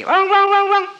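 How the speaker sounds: very high, loud barking howls; bay up at the hills to call the wolf; fox howling. Acted. Fox on the rock calling the wolf, loud high howls.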